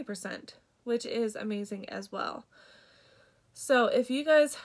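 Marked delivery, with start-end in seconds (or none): 3.6-4.6 congested. Real, not acted. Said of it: "I'm really congested".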